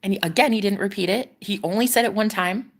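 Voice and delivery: ordinary talk, nothing marked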